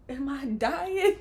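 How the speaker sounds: drawn-out question on the edge of laughter; mock bewilderment. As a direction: play up dazed alarm for laughs. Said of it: "I'm just looking at everybody like".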